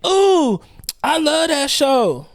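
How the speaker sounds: very high, drawn-out fan squeal; mock gushing. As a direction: imitate a swooning TV fan, slowly. Mimicking gushing fans of a show.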